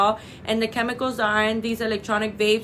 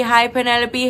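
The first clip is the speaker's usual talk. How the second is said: ordinary delivery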